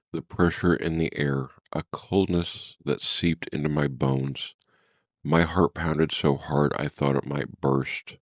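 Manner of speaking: ordinary delivery, nothing marked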